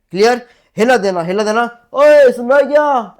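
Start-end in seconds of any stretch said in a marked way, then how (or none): 1.9-3.2 loud, high, drawn-out call across a distance. Shown as a demonstration. Teacher demonstrating a hela call to students. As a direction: hail someone far away, slow and loud. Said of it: "ऐसे देते हैं हिला".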